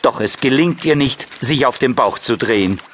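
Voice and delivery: ordinary delivery, nothing marked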